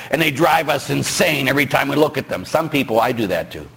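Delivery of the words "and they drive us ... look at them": normal talking voice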